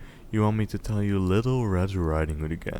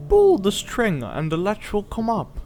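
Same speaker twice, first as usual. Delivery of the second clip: much higher, louder quavering granny; old granny; 13 semitones higher, 5 dB louder than usual. Acted. Grandmother in bed answers a knock, high old voice. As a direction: call out like a frail old woman. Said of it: "said the old lady".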